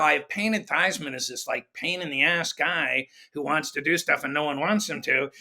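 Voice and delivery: ordinary talk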